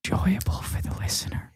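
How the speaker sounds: soft ASMR murmur; ASMR whisper. Pretend. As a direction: whisper it for the tingles. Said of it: "A S M R minute".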